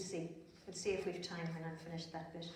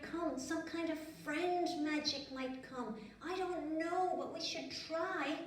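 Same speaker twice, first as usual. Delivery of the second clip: put-on higher, slow, gentle boy's voice; a friend reassuring her, pitched up; kind John; 7 semitones higher, slower than usual. A narrator's reading. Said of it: "And John said".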